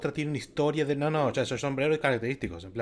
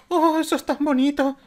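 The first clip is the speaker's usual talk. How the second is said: high loud mock gush over a sweet scene; 13 semitones higher, 8 dB louder than usual. Streamer mocking a tearjerker scene, squealing high and loud. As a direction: squeal it, half swooning, half hating it.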